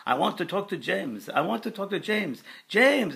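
put-on high, loud French-accented spirit voice; imitated Frenchman calling out; screaming Frenchman. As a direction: cry out urgently in a French accent. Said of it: "a Frenchman's voice came through".

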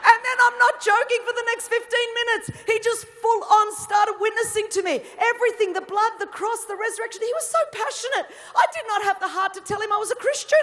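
real teary laughter at her own story; laughing while telling. Real, not acted. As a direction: crack up as you tell it. Laughing through the funny story.

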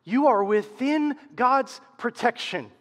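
usual speaking voice

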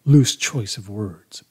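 ordinary delivery

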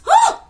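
very high loud gasp; dramatic gasp. A narrator's reading. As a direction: shock the listeners. Story gasp.

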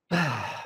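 low, soft exhale; sigh. Acted. Comedian reacting to a terrible remark, soft low sigh. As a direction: let out a weary sigh.

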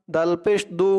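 ordinary talk, nothing marked

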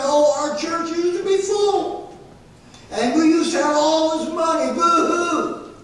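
high-pitched mock lament of churchgoers; mock weeping. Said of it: "There are people that are weeping".

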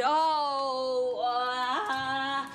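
long loud cry of surprise and pain; pained groan. Acted. Woman's water breaks mid-sentence, pained groan. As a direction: let out a drawn-out labor moan.

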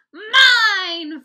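high, very loud drawn-out cry; gleeful. A narrator's reading. Fudge owns up to Mom about his tummy, gleeful. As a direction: crow it like a delighted toddler. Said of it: "Fudge laughed".

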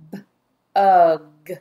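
"B-ug" with a lone b, then a stretched ug; sounded out. Shown as a demonstration. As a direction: tap out "B-ug" loudly, sound by sound.

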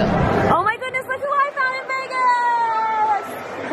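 very high, slow, delighted; excited greeting. Real, not acted. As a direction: gush with delight. Greeting a friend on camera.

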